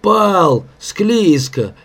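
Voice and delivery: making excuses. A narrator's reading. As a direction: excuse yourself meekly. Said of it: "тихо оправдывался Егорыч". (оправдываясь, виновато)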